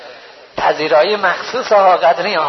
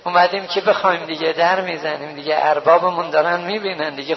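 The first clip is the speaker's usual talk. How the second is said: ordinary talk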